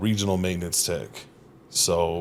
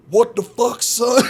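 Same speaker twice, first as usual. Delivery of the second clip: much higher, louder mock yell of outrage; foreman's outraged yell; 6 dB louder, slower than usual. Foreman catching the fake translation, outraged mock yell. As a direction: yell like a fed-up boss. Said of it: "And he's like".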